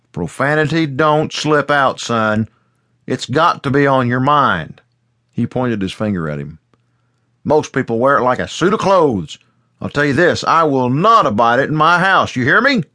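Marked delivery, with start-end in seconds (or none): 0.1-4.8 stern scolding father. A narrator's reading. Father scolding his son. 7.4-12.8 loud, high stern lecture; angry father. A narrator's reading. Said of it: "face was red with anger".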